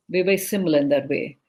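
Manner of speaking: usual speaking voice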